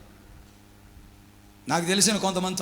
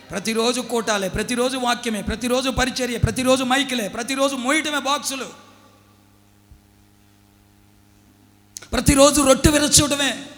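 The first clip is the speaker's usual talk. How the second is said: rising higher and louder each line; escalating sermon chant; building preaching; 5 semitones higher, 5 dB louder than usual. Acted. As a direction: stack them up to a shout.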